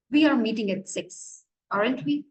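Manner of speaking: ordinary delivery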